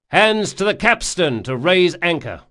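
loud, firm captain addressing crew; rally the men from the deck; commanding captain. A narrator's reading. Order to crew.

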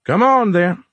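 high, loud folksy summons; calling them over. Acted. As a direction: beckon them from across the shop. Orlin calls them to front.

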